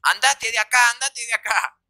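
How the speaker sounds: imitating an angry mother kicking him out; furious mother. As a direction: order him out like a fed-up mother. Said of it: "re caliente la vieja".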